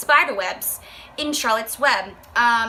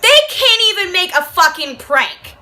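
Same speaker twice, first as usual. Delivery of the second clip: normal talking voice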